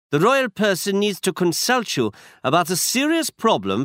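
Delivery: put-on the visitor states his problem in a German accent. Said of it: "He had a strong German accent".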